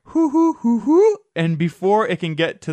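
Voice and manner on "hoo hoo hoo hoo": high hooting owl call. Retold or imitated. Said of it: "But you hear".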